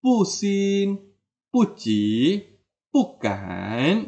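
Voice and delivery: "pusin, puci, pukan", each "pu" falling; fourth-tone pu. Shown as a demonstration. Teacher reciting examples, "pu" falling in each word. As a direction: drop "pu" in every example. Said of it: "akan berbunyi nada keempat".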